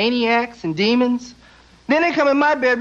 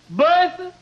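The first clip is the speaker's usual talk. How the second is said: higher, drawn-out call of "Bertha"; 5 semitones higher, slower than usual. Retold or imitated. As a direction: call across the house to your wife, bored. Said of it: "He's looking at my aunt going".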